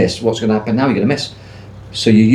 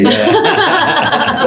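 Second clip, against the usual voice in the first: laughing. Real, not acted. Laughing at colleague remark.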